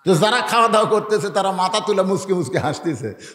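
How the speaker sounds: ordinary talk, nothing marked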